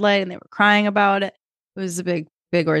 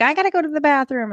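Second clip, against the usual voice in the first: put-on higher, fast little-kid voice; whiny kid; 8 semitones higher, faster than usual. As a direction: blurt it like an impatient five-year-old. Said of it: "the next kid would be like".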